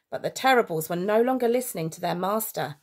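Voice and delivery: usual speaking voice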